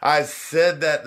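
high, slow, lofty self-admiration; mock grand. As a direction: savour your own words. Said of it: "it felt like".

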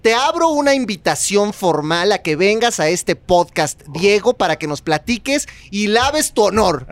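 slow, solemn mock-official announcement; slow, mock-solemn invitation to the absent friend; mock formal invitation. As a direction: invite him like an official proclamation, slowly. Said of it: "formal podcast invitation".

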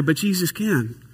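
usual speaking voice

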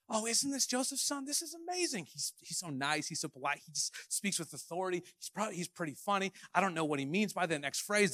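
a lightly voiced admiring townsman; gushing admirer. Crowd imitated as admiring fans.

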